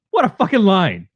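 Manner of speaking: high, emphatic relish; delighted raving. Real, not acted. Host raving to co-host about a favourite movie line, delighted.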